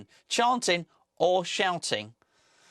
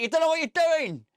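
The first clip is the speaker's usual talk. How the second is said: mock frustrated shout; 12 semitones higher than usual. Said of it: "start shouting out".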